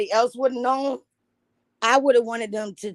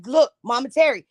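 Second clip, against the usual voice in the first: usual speaking voice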